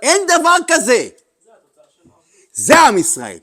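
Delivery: loud, high preaching at full force; fiery preaching. Acted. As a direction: thunder it to the room. (דרשה סוערת בקול רם)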